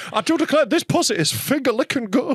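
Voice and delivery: hammy Southern colonel, laughing through it; Colonel Sanders voice. Pretend. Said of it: "I was the colonel from K F C".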